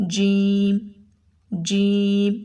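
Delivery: جیم said clearly with the tongue touching the palate; letter jeem. Shown as a demonstration. Teacher modelling the letter جیم for students. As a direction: model جیم slowly and distinctly. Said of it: "وہ حرف ہے".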